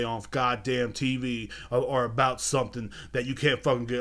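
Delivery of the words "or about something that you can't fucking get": ordinary delivery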